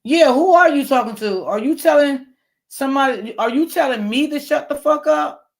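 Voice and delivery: angry retort. Real, not acted. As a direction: fire the question back, offended. Streamer confronts a rude commenter.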